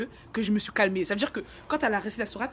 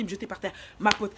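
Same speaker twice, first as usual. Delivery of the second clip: normal talking voice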